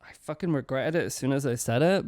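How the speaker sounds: ordinary talk, nothing marked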